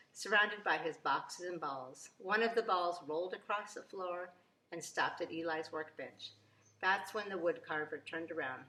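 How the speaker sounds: usual speaking voice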